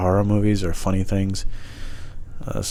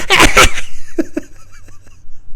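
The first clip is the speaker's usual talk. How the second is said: very high, loud breathy cackle; exaggerated squealing giggle; mocking laugh imitation; 6 dB louder than usual. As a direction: mimic a silly laugh in bursts. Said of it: "and she's like".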